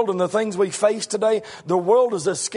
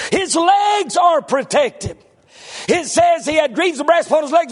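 full-force preaching; 7 semitones higher than usual. Real, not acted. Preacher builds the giant's might to his congregation, full force. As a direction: drive the point like a revival preacher.

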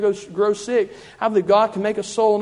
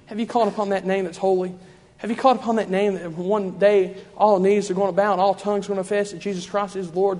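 usual speaking voice

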